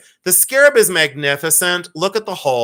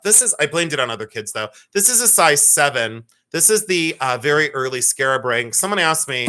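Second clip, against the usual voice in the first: ordinary delivery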